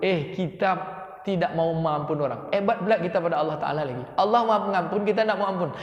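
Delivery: ordinary talk, nothing marked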